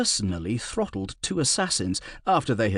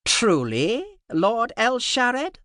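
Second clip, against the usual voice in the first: high, slow and mocking; a cutting ruler's question; harsh nasal voice; 12 semitones higher, much slower than usual. A narrator's reading. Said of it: "He had a harsh, nasal voice".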